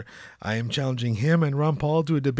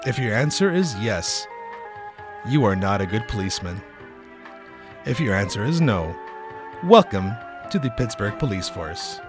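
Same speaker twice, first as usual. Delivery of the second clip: instructional announcer. Acted. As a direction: read it like a training video. Reads mock tutorial over music.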